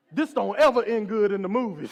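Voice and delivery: loud, comic alarmed protest; hammed-up worried outburst; mock alarm. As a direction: blurt it out in mock dread. Alarmed reaction to policeman's order.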